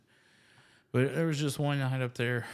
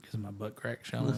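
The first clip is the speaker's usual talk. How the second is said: ordinary talk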